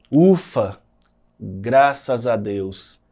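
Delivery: a loud, relieved "Ufa" then a slow "graças a Deus"; relieved exclamation. Shown as a demonstration. Teacher demonstrating a relieved interjection to the class. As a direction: breathe out "Ufa" like a weight just lifted. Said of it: "ponto de exclamação também é utilizado após interjeição".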